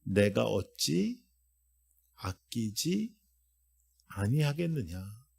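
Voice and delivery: slow, soft solemn question; voice of God. Acted. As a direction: speak as a tender, grieving God. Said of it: "하나님께서 이렇게 말씀하십니다".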